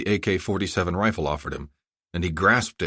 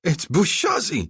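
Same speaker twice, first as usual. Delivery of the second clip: much higher, slow gleeful cry; gleeful shout; 12 semitones higher, slower than usual. Acted. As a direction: shout with delight at spotting him. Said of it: "Zolkadar shouted in glee".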